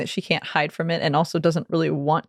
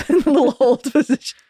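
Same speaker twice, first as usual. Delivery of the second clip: laughing; 8 semitones higher, 6 dB louder, faster than usual. Real, not acted.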